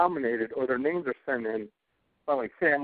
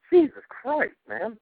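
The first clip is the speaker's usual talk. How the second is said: laughing exasperation; 11 semitones higher, slower than usual. Real, not acted. Laughing at his own anger.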